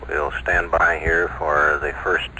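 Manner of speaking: ordinary talk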